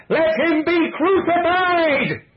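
slow, screaming high mob yell; long, drawn-out bloodthirsty shout; demonic roar. A narrator's reading. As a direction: roar every word at full force. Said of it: "roared like demons".

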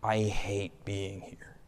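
low, hushed inner thought; quiet private thought. Retold or imitated. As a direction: mutter it to yourself, disgusted. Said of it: "And I'm thinking".

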